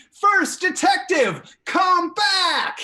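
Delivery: high, drawn-out shouted summons; full yell. Real, not acted. Host calling the detective back with viewers, full yell. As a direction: yell it loud and slow, high in pitch. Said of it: "to yell with me".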